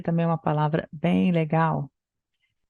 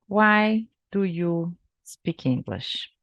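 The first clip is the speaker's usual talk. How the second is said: slow question; slower than usual. Shown as a demonstration. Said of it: "O que que você responderia".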